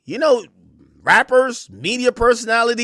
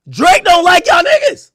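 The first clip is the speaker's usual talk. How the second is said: louder, higher mocking quote; raised mock quote; 8 semitones higher, 6 dB louder than usual. Co-host mocking a radio host's claim, louder and higher. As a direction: throw it back at him loudly.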